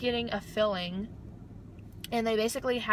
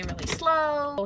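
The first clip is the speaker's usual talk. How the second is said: slow; 5 semitones higher, much slower than usual. Retold or imitated. Dentist promising to go slow.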